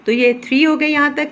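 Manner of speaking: ordinary talk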